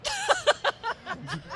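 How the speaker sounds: high, greedy character cackle; gleeful cackle. Acted. Groundskeeper cackling at the warden's permission to loot. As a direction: cackle like a scheming urchin.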